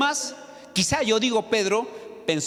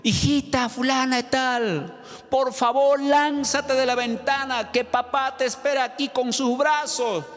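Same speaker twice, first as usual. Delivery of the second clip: father calling out; 6 semitones higher than usual. Retold or imitated. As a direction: urge his daughter to jump, slowly. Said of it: "el papá le dijo por su nombre". (padre suplicando en voz alta a su hija)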